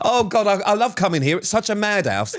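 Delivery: high, hammed-up laughing and gushing; mock delighted guest. Visitor raving about the house, mock delighted. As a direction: pretend to be hugely amused. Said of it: "come to your house and go".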